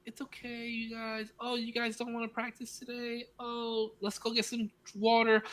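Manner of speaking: high, soft, coddling coach; high sing-song coaxing of kids. Retold or imitated. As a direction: mimic a pushover coach sweetly. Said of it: "He was like".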